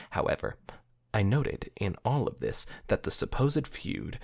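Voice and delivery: soft, measured storytelling voice; story narration. A narrator's reading. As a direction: read it like a somber tale. Reads the story aloud.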